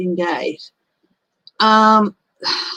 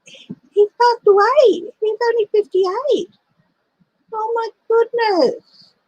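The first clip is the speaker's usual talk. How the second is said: much higher, slow, dismayed voice; stunned grief; 13 semitones higher than usual. Real, not acted. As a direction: hit hard by sudden sad news. Reads that Sean Locke died.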